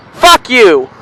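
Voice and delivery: high loud curse at a driver; real road rage, fired at the car; angry yell. Real, not acted. Cursing another driver on road.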